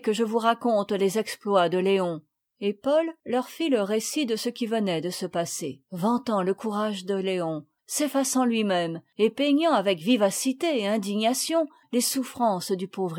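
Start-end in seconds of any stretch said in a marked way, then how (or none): none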